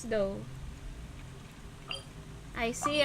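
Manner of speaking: ordinary talk, nothing marked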